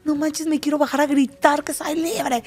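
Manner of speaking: high, bright-eyed burst of joy; imitating her own giddy excitement; excited outburst. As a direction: let the freedom spill out. Wanting to shout she's free.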